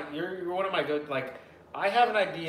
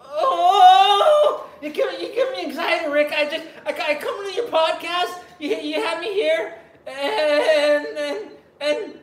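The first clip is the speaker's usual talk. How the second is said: put-on high, loud, stammering anxious teen; Morty impression; 13 semitones higher, 9 dB louder, slower than usual. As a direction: play the nervous squeaky kid, laughing through it. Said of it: "You do a horrible Morty impression".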